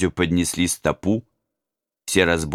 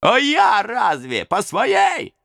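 high, slow plaintive robber voice; another robber; 3 dB louder, much slower than usual. Acted. Robber to a comrade, high slow plaintive voice. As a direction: pick up the complaint. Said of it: "подхватил другой".

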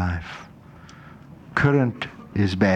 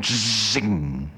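zing sound effect; 2 semitones lower, 4 dB quieter than usual. Shown as a demonstration. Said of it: "penetrates into the bone".

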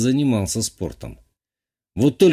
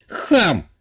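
indignant snap; 6 semitones higher than usual. A narrator's reading. Offended girl snaps.

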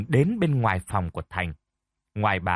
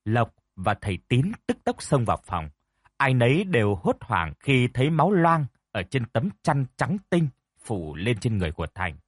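plain reading voice

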